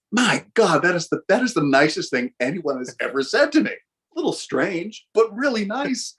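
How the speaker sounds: high, gushing delight, slowing at the end; high-pitched flattered gush. Retold or imitated. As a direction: gush over a lovely compliment. Retold delighted reply to praise.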